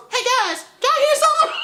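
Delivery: squeaky high innocent question; Alvin chipmunk voice. Retold or imitated. Reviewer voicing Alvin after the fart, high and squeaky. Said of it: "Alvin looks up and goes".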